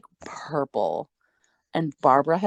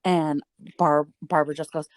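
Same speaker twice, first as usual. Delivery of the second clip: usual speaking voice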